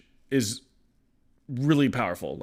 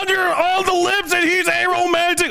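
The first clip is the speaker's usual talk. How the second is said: higher, hammed-up incredulous bro voice; mock talking at his level; 13 semitones higher, 3 dB louder than usual. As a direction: play dumb and shocked, pitched up. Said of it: "I'm gonna talk at your level".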